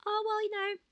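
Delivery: high, sing-song mock of smug advice; mocking chirpy voice. Mocking society's advice to women in their thirties, high and sugary.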